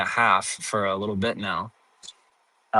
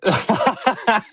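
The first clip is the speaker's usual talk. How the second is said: giggle; 9 semitones higher than usual. Real, not acted. Laughing at failed test.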